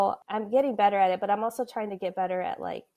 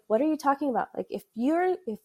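higher-pitched, mild disbelief; retold puzzled coaching; incredulous cousin; 7 semitones higher, faster than usual. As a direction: gently challenge the excuse. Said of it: "he's like".

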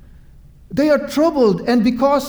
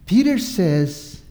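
normal talking voice

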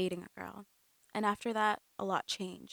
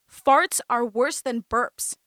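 loud, slow, higher exclamation; exclaiming; 4 semitones higher, 10 dB louder, slower than usual. A narrator's reading. Cousin insists to Amanda that farts are worse, indignant. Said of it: "she exclaimed".

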